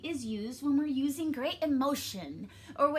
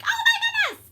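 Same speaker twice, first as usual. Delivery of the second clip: very high, loud outburst; sudden squealed exclamation; exclaiming; 14 dB louder than usual. Shown as a demonstration. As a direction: cry it out in big excitement. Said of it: "you exclaim".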